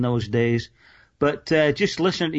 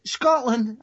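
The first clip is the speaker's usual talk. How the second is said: high, stunned echo of Scotland; baffled repeat; 8 semitones higher than usual. Retold or imitated. Stranger repeats Scotland in disbelief, baffled high echo. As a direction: play the confused stranger, higher.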